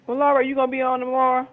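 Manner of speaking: usual speaking voice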